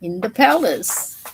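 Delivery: ordinary delivery, nothing marked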